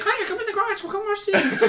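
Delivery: high, sweet calling voice; girl's voice. Retold or imitated. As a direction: coax him into the garage sweetly. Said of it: "She's like".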